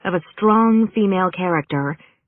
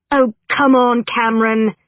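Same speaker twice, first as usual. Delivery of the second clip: higher, louder burst of exasperation; exasperated; 7 semitones higher, 5 dB louder than usual. A narrator's reading. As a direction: cut in, fed up with your agent. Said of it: "Jasmine said with exasperation".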